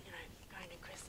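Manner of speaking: ordinary talk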